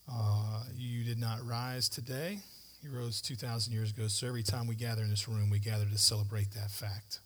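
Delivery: leading the congregation in prayer; pastoral prayer. Real, not acted. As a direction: pray humbly for the room.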